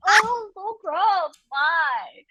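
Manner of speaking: slow, high disgusted whine; grossed out. Retold or imitated. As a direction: recoil from it, slowly. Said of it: "I'm like".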